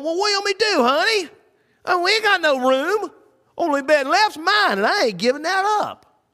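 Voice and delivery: high, drawling innkeeper grumbling to wife. Retold or imitated. Innkeeper voiced in retold story.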